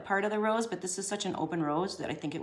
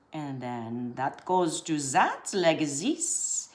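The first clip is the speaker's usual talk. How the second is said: slow, drawn-out comic voice; playful mimicry of an ad voice, stretched endings; silly commercial voice; much slower than usual. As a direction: draw it out in a goofy put-on voice. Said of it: "I won't do that voice anymore".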